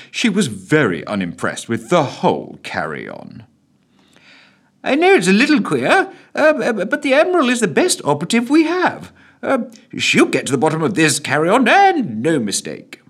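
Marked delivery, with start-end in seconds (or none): 4.8-13.0 defence secretary. A narrator's reading. Defence Secretary found his voice.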